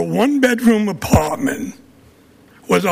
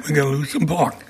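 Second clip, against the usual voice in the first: softer, lower Brooklyn accent; 6 semitones lower, 5 dB quieter than usual. Shown as a demonstration. Speaker repeating himself to council, Brooklyn accent. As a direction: say it in Brooklyn speech. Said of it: "In my Brooklyn accent".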